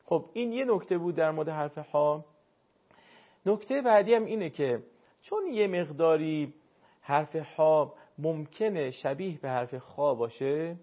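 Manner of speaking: ordinary talk, nothing marked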